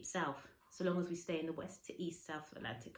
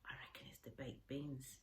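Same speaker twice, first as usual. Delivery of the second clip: softer, a conspiratorial whisper; whispered aside; 4 semitones lower, 11 dB quieter than usual. Acted. Boy blaming the baked beans, cheeky whisper. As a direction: share the joke under your breath.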